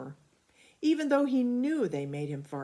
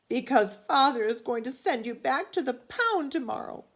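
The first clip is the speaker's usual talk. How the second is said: put-on higher, louder worried boy; upset child; 8 semitones higher, 7 dB louder than usual. A narrator's reading. Billy warning Walter about the pound, high and worried. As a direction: warn the dog urgently.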